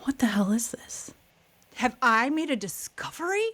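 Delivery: hushed, breathless whisper of wonder; awed whisper. Retold or imitated. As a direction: whisper it like you found treasure. Amazed at a barn find.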